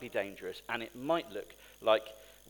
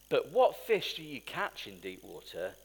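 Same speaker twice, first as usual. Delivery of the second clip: ordinary talk, nothing marked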